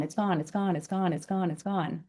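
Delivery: fading repetition. Acted. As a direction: let the words dissolve like the moment. Repeating "gone", letting it fade.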